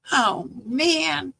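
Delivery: put-on high, drawn-out kid's groan of words; mock child's dismay. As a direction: imitate a grandson let down by his game. Voices grandson at his video game.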